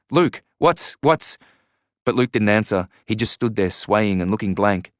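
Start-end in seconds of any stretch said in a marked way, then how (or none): 0.1-1.4 urgent yelling. A narrator's reading. Yelled appeal to bystander continues.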